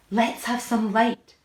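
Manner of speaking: loud, slow call into the dark; shouted. A narrator's reading. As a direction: demand light, loud and drawn out. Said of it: "shouted the centipede".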